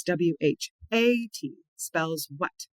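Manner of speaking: slow letter-by-letter spelling, "A" drawn out; measured spelling chant with emphatic "A"; stress on A. Shown as a demonstration. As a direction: spell it slowly and lean on the "A". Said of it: "I emphasize the A".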